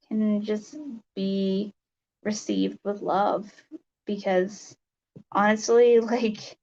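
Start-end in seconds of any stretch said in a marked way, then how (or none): none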